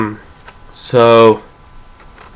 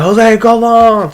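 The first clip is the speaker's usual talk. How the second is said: calling out; 11 semitones higher, much slower than usual. Retold or imitated. Teammates calling out.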